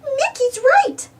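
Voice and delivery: very high, slow Minnie. Acted. Minnie agreeing with Mickey, very high and slow. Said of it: "said Minnie".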